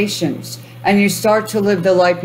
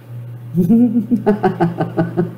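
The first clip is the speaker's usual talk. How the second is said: demo laugh; 3 semitones higher than usual. Shown as a demonstration. Host laughs to get viewers laughing. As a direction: laugh first so they join in. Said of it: "I command you to start laughing".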